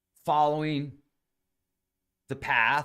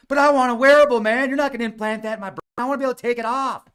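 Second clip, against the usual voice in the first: much higher and louder, rushing the last lines; refusing the brain implant in a panicky squeal; excited protest; 10 semitones higher, 10 dB louder, faster than usual. Pretend. Himself in the dream refusing Elon Musk's implant, high, loud, fast protest.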